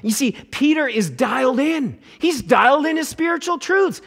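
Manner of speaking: high, loud, rising preacher's fervour; fired-up pulpit delivery; fervent preaching. Acted. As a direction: build the excitement toward the peak. Preacher builds to fervent peak.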